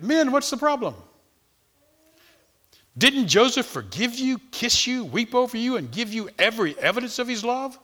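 high-pitched run of challenging questions; incredulous remonstrating. Acted. Readers asking Joseph's brothers why they are so alarmed, incredulous. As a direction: press them, baffled at their doubt.

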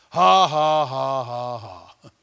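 loud, slow ha-ha-ha fading down; measured laugh starting big and sinking in pitch; deliberate fake laugh. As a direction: laugh on purpose, syllable by syllable. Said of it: "He just laughed like this".

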